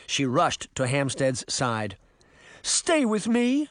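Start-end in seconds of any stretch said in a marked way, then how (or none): 2.6-3.7 slow, high-pitched plea; drawn-out entreaty, pitched way up; begging. A narrator's reading. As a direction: implore him, stretching the words. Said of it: "she begged".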